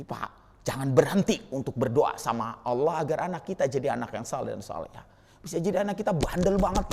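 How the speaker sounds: ordinary talk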